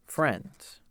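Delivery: ordinary delivery, nothing marked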